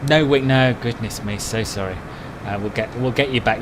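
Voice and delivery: ordinary delivery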